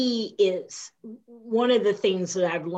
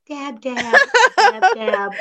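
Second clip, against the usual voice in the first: higher, dainty mock dabbing of tears; mock movie star; 7 semitones higher, 9 dB louder, slower than usual. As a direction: play a diva dabbing her eyes. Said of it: "the movie stars where they're like".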